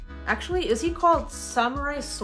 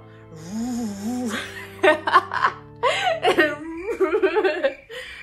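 imitated revving noise breaking into loud laughter; high, loud revving sound, then cackle; playful chainsaw noise dissolving into laughs; chainsaw dog imitation; 7 semitones higher, 8 dB louder than usual. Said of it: "You go".

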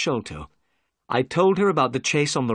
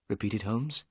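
usual speaking voice

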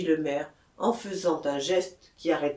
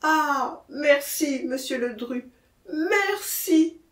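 high, fervent thanks; heartfelt thanks, rising on the repeat; profound gratitude; 8 semitones higher, 4 dB louder, slower than usual. A narrator's reading. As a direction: thank him with overwhelming relief. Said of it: "avec l'expression d'une profonde reconnaissance".

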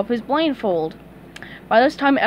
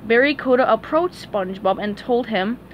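plain reading voice

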